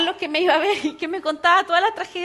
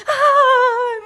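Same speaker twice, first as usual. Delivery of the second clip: shrieking much higher and louder; panicked mother-in-law; 10 semitones higher, 11 dB louder than usual. Retold or imitated. Mother-in-law panicking at the bedside, loud high wail.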